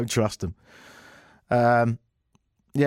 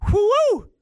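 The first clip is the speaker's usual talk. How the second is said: loud, high-pitched whoop; mock cheer; 5 dB louder than usual. Host sarcastically celebrating Apple solving a non-problem, mock cheer.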